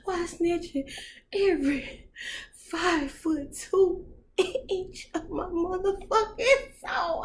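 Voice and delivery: high, slow, crying plea; wailing cry. Retold or imitated. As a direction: wail it in mock anguish. Overwhelmed, crying out.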